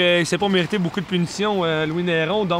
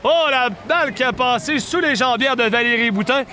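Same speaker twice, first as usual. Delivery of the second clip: higher, excited goal call; excited sports commentary; 4 semitones higher than usual. Real, not acted. Goal call in live play-by-play.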